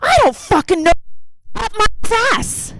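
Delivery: high, strained mock scream; mother's scream. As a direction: imitate an exasperated mother screeching. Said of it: "And she would scream".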